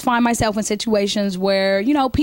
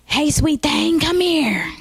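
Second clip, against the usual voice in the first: higher, lightly voiced old man calling; 6 semitones higher, much slower than usual. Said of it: "when I do the old man".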